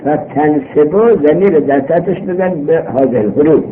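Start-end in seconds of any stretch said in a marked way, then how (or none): none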